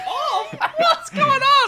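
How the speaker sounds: high, drawn-out shout through laughter; mock outraged shout. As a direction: shout it slowly in hammed-up disbelief. Mock uproar at the rating.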